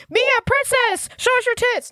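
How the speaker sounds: very high-pitched mock heckler; hammed-up, high paparazzi yell; shouting paparazzi. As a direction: call out like pushy photographers. Acting paparazzi in the helicopter.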